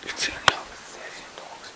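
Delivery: hushed whisper. Real, not acted. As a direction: whisper it under your breath. Sudden whisper at night.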